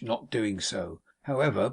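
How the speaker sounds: ordinary delivery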